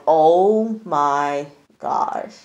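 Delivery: very slow, stretched-out imitation; stunned dismay. Imitating Sam hearing about Jordan's face, in drawn-out dismay. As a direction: drag each word out in disbelief.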